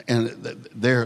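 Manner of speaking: normal talking voice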